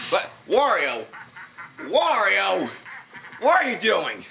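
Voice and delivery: loud, high calls of disbelief; incredulous yelling. Real, not acted. Player calling out Wario on screen, incredulous yell.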